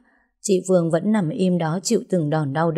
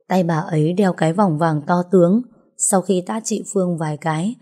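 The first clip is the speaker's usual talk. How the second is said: plain reading voice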